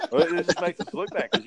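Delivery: ordinary delivery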